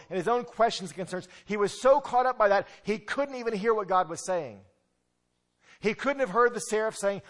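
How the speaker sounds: loud, high preaching build; fervent preaching. Acted. Preacher pressing the congregation, loud and fervent. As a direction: preach it with rising urgency.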